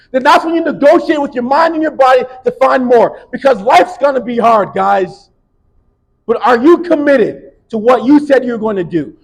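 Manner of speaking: loud, high, driving exhortation; rally the team with everything; full-force motivational preaching. Real, not acted. Speaker to athletes, loud fired-up preaching.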